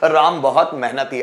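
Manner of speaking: ordinary talk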